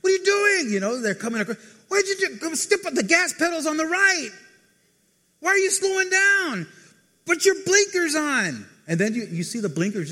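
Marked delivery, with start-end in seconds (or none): 0.1-0.7 high, loud road-rage yell; hammed-up shout at another car. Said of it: "you got road rage?". 1.9-6.7 high, loud exasperated yelling; road rage. Acted. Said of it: "you got road rage?". 7.2-8.7 high, loud, drawn-out yell; hammed-up driver's shout; road rage. Acting out a driver behind a slow car, slow high angry yell. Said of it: "you got road rage?".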